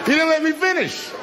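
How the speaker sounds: high-pitched squawk of protest; comic protest. Real, not acted. Comedian to a crowd that laughed too soon, comic protest. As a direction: shoot way up high to object.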